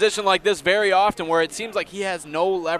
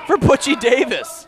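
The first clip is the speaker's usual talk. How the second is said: shout; 6 semitones higher, 3 dB louder, slower than usual. Real, not acted. Commentator hypes wrestler name.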